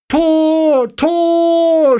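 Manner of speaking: very high, long-held scream of goal; screamed goal call. A narrator's reading. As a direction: scream it like a radio commentator. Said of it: "schreit es aus dem Radio".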